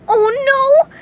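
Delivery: high, loud wail of dread; high cartoon alarm; frightened yell. A narrator's reading. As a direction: yell while trembling with fear. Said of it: "yelled SpongeBob, trembling".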